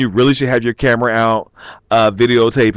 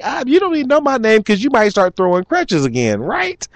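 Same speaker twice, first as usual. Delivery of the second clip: teasing; 10 semitones higher than usual. Retold or imitated. Teasing the crutch thrower.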